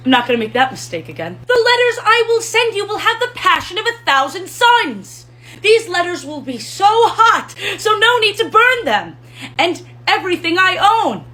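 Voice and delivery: high, loud, overwrought romantic declarations; hammed-up Ferrelton. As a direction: swoon over a Valentine in character. Ferrelton character voice.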